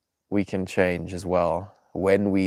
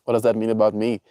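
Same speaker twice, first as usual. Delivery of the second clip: usual speaking voice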